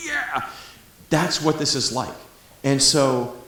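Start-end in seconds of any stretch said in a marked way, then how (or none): 0.1-0.5 a high-pitched thrilled yelp; excited cheer. Retold or imitated. Reaching totality like a movie climax, high excited cheer. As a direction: let the big moment burst out of you, high. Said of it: "you're like".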